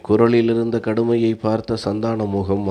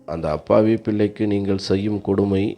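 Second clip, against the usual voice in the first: ordinary delivery, nothing marked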